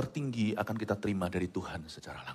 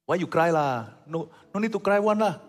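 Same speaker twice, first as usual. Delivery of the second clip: put-on Singaporean accent; 7 semitones higher than usual. Singaporean telling someone not to cry, imitated Singlish accent. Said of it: "Orang Singapura ngomong begitu kan".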